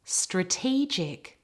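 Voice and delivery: slow "Strategic", weight on TEE; stress on middle syllable. Shown as a demonstration. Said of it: "It's in the middle of the word".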